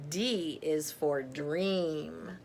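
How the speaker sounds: ordinary delivery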